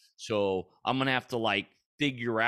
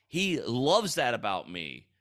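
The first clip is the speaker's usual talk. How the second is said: ordinary talk, nothing marked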